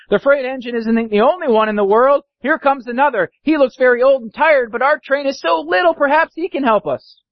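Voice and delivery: high hopeful clown; hopeful toy clown. Acted. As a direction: lift the pitch, cheering up the toys.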